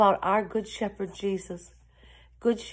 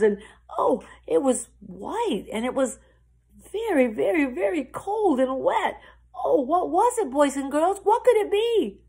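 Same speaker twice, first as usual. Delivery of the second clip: higher, building to a loud question; animated storyteller amazement; excited wonder; 6 semitones higher, 5 dB louder than usual. A narrator's reading. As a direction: get the children guessing, wide-eyed with excitement. Excited guessing game with children.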